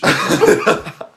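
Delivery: a burst of high, loud laughter. Real, not acted. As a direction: crack up loudly at the joke. Laughing at own impression.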